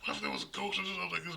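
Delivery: high, fast mock girlfriend voice, half-whispered; offended girlfriend. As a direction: squeak it out like an indignant girlfriend. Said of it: "And she's like".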